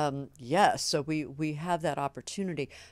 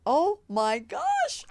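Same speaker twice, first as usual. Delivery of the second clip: much higher, slow astonished oh my gosh; amazed gasp; 12 semitones higher, 5 dB louder, much slower than usual. Real, not acted. Reading the book aloud to listeners, amazed. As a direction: gasp at what you just read. Surprise at the text.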